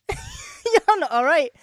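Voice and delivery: high, loud, slow words through laughter; laughing. Real, not acted. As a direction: crack up mid-sentence. Laughing at herself.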